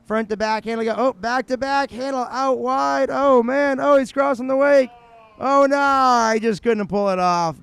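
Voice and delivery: high, loud call building to dismay; live play-by-play. Real, not acted. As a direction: call the run as it goes wrong, loud and high. Live play-by-play of a run that fails.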